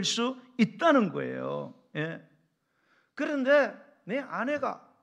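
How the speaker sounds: loud, high, drawn-out preaching; impassioned pulpit delivery, slow and ringing; full-force preaching. Real, not acted. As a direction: drive each phrase home to the congregation.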